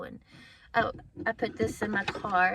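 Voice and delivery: ordinary delivery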